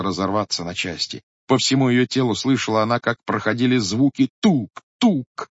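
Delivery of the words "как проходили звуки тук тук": plain reading voice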